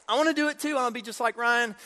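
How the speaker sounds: high-pitched pleading little brother; eager little kid. Acted. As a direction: beg to join the big kids. Voices himself as eager little brother.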